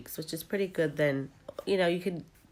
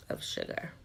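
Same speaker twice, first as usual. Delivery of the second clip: breathy whispered aside; 6 dB quieter than usual. Real, not acted. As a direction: drop to a secret whisper. Whispered aside about taste.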